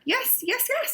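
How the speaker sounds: high, eager repeated yes; eager yeses. Acted. Skunk begging for another hug, high and eager. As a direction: bounce with excitement. Skunk wants more hugs.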